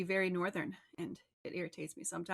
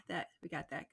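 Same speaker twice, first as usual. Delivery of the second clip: softer, lower repeat of that; quiet echo; 5 semitones lower, 6 dB quieter than usual. Real, not acted. Host echoing his own words to himself, quietly. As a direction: murmur it again to yourself. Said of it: "now that we got that".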